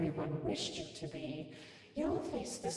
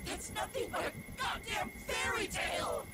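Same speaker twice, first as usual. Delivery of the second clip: high-pitched, angry cry; bitter outburst. Acted. Woman rejecting a fairy tale, bitterly angry. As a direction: throw the idea out in fury.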